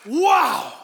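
one big, put-on exclamation of awe; imitated amazement. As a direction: act out a crowd's astonishment.

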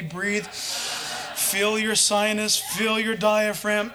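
slow, drawn-out coaching of the breath; slow imitated voice-coach instruction. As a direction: stretch each word like a voice coach. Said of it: "he's the one telling me".

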